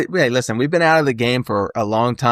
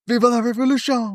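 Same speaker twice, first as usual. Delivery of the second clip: mock rallying cry; 12 semitones higher than usual. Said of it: "And, like".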